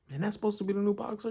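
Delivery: high, fast, disbelieving coach voice; incredulous coach. Retold or imitated. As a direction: scoff at the scrawny new kid. Coach mocking new boxer.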